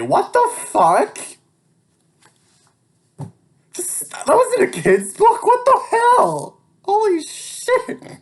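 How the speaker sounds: can't believe what you wrote; real shock breaking into a shriek; stunned disbelief. Real, not acted. Shocked by own childhood book.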